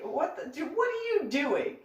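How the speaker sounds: a mom's high-pitched what are you doing; shocked mom. Retold or imitated. Mom catches daughter, calls out.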